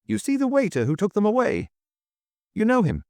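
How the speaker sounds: very high, agitated question; urgent, excited pitch leap; excited panting. A narrator's reading. Excited hotel keeper questioning the chairman, agitated. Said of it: "panted the excited hotel keeper".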